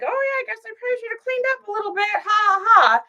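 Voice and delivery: high, fast, airy realtor brushing it off with a laugh. Retold or imitated. Mocking the careless realtor, high, fast and laughing. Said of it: "the realtor's like".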